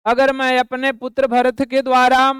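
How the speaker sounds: ordinary talk, nothing marked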